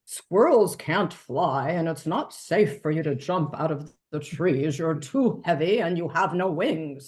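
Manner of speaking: Father Robin. A narrator's reading. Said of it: "Father Robin cocked his head".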